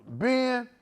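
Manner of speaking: high, hammed-up preachy father scolding; mock-stern dad voice shouting about angels; strict churchy dad. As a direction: lecture the son like a fired-up holy dad. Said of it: "I was crunk".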